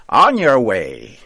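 loud, shooing; brisk witch. A narrator's reading. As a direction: shoo him out the door. Pushing him out the door.

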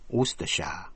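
"Worcestershire" in three syllables, stress on WUS; Worcestershire said right. Shown as a demonstration. Teacher closing the spelling with the spoken word. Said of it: "gọi là Worcestershire".

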